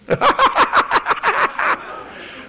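high burst of cackling; big high belly laugh put on for a story; acted laughter. Retelling a mocking questioner's laughter.

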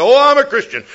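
high, loud, eager volunteer; mock eager answer. Pastor mocking himself at the airline desk, eager pipe-up. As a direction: pipe up like a keen schoolboy. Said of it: "I raised my hand and said".

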